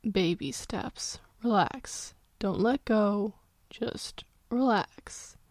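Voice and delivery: soft, slow reassuring father; calm her gently, slowly; soft, slow comfort for a scared child; soothing comfort. Acted. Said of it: "infused as much comfort and confidence".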